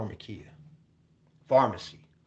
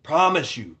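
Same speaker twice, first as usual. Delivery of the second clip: ordinary talk, nothing marked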